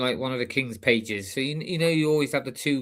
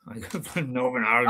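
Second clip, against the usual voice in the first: Northern Irish accent; 2 semitones lower than usual. Shown as a demonstration. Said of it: "you wanna go Martin".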